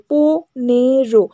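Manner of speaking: পনেরো spoken very slowly, each syllable counted. Shown as a demonstration. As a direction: stretch পনেরো into separate beats. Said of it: "করে গোনো".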